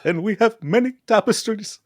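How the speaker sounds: high, hammed-up Scottish lord accent, indignant; mock Scottish lord accent. Host mocks the castle tapestry scene in a hammed-up Scottish accent. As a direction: play an offended Scottish lord in a put-on Scottish accent. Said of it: "I can do all those accents".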